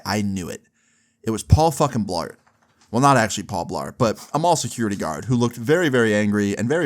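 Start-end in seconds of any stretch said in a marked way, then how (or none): none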